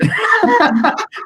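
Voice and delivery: a quick high burst of laughter; real laugh. Real, not acted. Guest laughing at his own secret-keeping joke. Joking about hiding millennials.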